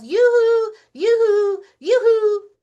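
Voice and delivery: very high, loud yoo-hoo; calling out. Acted. Rabbit calling for Hermit Crab, very high and loud. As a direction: call across the park, way up high. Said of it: "Rabbit calls".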